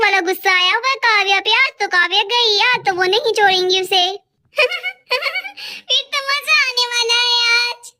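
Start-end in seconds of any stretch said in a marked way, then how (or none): 4.6-5.8 high giggle of delight; high, soft giggle of delight; gleeful giggle. Acted. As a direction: laugh at the coming fun. Gleeful laugh at the trouble.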